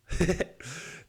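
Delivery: high, short chuckle; amused laugh. Real, not acted. Laughing at not knowing the pronunciation, amused. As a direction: chuckle at your own confusion.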